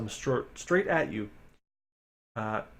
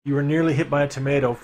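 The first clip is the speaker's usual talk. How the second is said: ordinary talk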